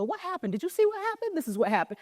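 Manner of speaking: high, excited neighbor chatter; gossiping bystanders on the street; curious neighbors. Retold or imitated. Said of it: "people start to gather outside and go".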